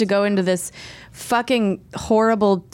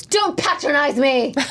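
slow, higher offended voice; offended retort; 5 semitones higher than usual. Retold or imitated. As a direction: snap back, slow and wounded. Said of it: "then somebody goes".

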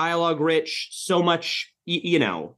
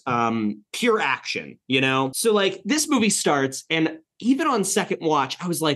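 ordinary talk, nothing marked

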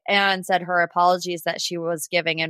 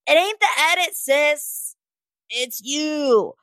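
sassy callout; 6 semitones higher, slower than usual. Real, not acted. Calling out Shanae's excuse.

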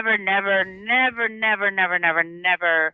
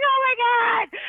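high strained panicky squeal; anxious wail; 14 semitones higher, slower than usual. Retold or imitated. Actress panicking over the wait, high panicky squeal. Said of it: "And I'd be like".

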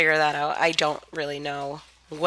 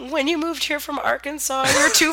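mock nostalgic; 8 semitones higher, 4 dB louder than usual. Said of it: "she's just like".

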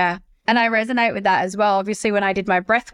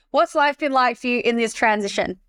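ordinary talk

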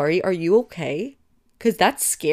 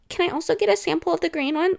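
high, fast imitation of a sly ask; mock-innocent, rushed ask; sneaky request; 9 semitones higher, much faster than usual. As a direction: slip the request in quickly, up high. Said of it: "She's sneaky".

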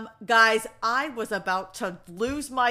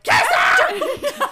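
shrieked, laughing, much higher and louder; laughing shout; 10 dB louder than usual. Retold or imitated. Reader yelling at characters to kiss, laughing shout. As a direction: yell it at the page through laughter.

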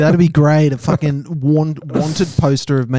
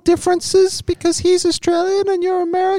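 high-pitched girlfriend impression. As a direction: squeak it out as a mock girlfriend. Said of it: "My girlfriend's got quite a high-pitched voice".

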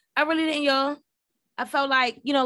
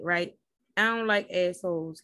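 usual speaking voice

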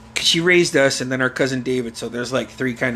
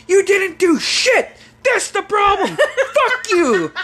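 very high, louder enraged yelling; mock furious mother; 4 dB louder, slower than usual. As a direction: scold him in a high, stretched yell. Mother keeps raging.

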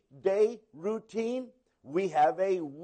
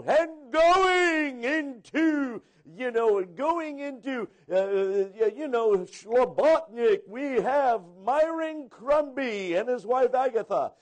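mock announcer; 5 semitones higher than usual. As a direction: ham it up like a conference host calling couples up. Conference announcing new missionary couples.

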